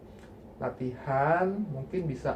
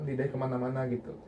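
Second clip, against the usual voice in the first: ordinary delivery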